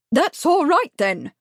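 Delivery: high and loud, bowing grandly; booming. A narrator's reading. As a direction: boom it across the room. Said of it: "boomed Miss Truebody".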